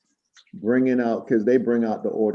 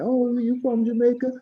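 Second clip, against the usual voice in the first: much higher, put-on woman's voice; imitated store lady; 13 semitones higher than usual. Store lady asking about Jamaica, high imitated voice. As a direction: pitch it way up as the surprised customer. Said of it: "she was like".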